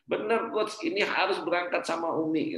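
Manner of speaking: high-pitched, emphatic retold urging; insistent assurance. Acted caller insisting he must go.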